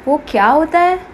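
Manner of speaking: baffled, animated repeat; incredulous echo. Acted. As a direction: repeat the question back in disbelief, pitch rising. Companion echoes the robot in disbelief.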